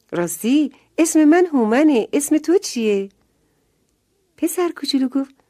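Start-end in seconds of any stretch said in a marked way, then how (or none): 0.1-3.1 put-on higher, louder friendly child voice; a boy keen to make a new friend; eager little boy. A narrator's reading. Houman introduces himself to Ramin, eager and higher. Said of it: "هومن گفت".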